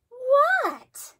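surprised squeak; startled Froggy. A narrator's reading. Froggy startled at the lake, squeaky.

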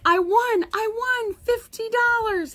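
high, loud victory cry; triumphant shout. A narrator's reading. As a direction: celebrate at the top of your voice. Winner celebrates alone in the barn.

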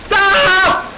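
sudden high shriek of fright; startled scream. Real, not acted. Streamer jumps at a scare, shrieking. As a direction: yelp in real shock. Jump scare in horror game.

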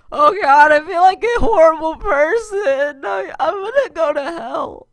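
high, loud alarmed cry; loud, high mock-horror; mock guilty outburst. Real, not acted. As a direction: blurt out sudden guilt, hammed up. Guilt over a joke.

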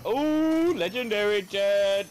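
high, rising ooh of delight; light up at rare loot; real thrilled exclamation; excited find. Real, not acted. Spots legendary loot in game.

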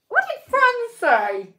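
drawing out a sibling's question; sister's voice. Retold or imitated. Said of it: "turns around and says".

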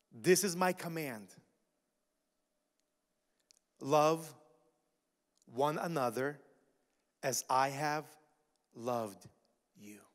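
each word set apart by long pauses; slow, weighty reading with pauses; read slowly. Shown as a demonstration. Pastor slowly reading Jesus' words to the church. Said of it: "let me read this slowly".

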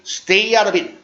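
brisk, linked "stay out of it". Shown as a demonstration. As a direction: run it together at natural speed. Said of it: "발음을 한번 연습할게요".

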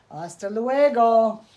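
loud mocking goodbye; taunting farewell. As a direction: shout it back as you run off. Rabbit taunts leaving coyote.

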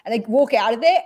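ordinary talk

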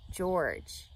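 ordinary delivery, nothing marked

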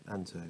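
"anto" with a hard t, softly; hard t pronunciation. Shown as a demonstration. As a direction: mull over "anto" as AN-toe, falling. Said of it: "is that anth or ant?".

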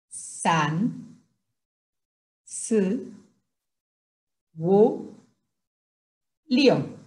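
Mandarin numbers one by one, long pauses between; counting drill. Shown as a demonstration. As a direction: model each syllable separately for learners to repeat. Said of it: "vamos a tratar de hacerlo de corridito". (conteo pausado en chino, sílaba por sílaba)

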